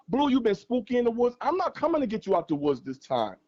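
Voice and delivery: ordinary delivery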